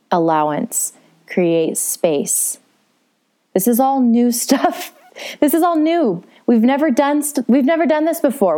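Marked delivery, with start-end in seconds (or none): 3.5-8.6 excited. Real, not acted. Teacher telling listeners this is all new, excited laugh. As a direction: burst out with eager delight.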